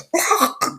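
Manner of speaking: high, short strangled cry; stabbed choking gasp. Acted. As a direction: choke out a cry of pain. Man gets stabbed.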